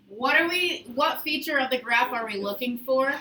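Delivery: usual speaking voice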